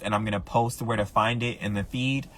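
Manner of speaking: normal talking voice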